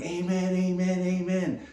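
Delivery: shouting. Retold or imitated. Guy on a bolting donkey yells for it to stop, high frantic shout. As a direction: yell amen to stop the runaway donkey. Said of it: "And the guy's shouting".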